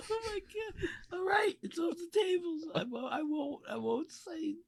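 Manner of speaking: high, soft squeal through laughter; weak, high-pitched promise to behave; laughing surrender. Real, not acted. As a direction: give in helplessly, squeaking with laughter. Cowed reaction to the angry message.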